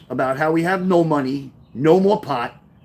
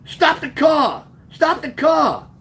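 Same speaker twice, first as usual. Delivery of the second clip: high, drawn-out urgent call; raised-pitch emphatic order, repeated; urgent command; 9 semitones higher, slower than usual. Retold or imitated. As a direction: act out calling to the driver in alarm. Friend urgently tells driver to stop.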